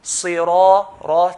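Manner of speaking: Sira, ra with the ra drawn out slowly; two-count stretch. Shown as a demonstration. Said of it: "two counts".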